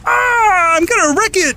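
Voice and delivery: high strained frog voice; Kermit the Frog. Pretend. Auditioning as Kermit, high puppet voice. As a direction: pitch it way up like Kermit. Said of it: "Kermit the Frog".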